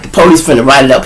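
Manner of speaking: usual speaking voice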